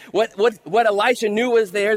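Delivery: usual speaking voice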